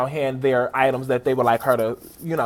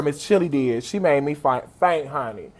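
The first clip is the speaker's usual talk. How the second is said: ordinary delivery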